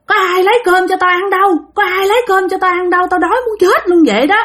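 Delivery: a cranky grandma berating her family for food; bà già chửi bới, giọng cao; scolding old woman. Acted. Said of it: "chửi quá trời chửi".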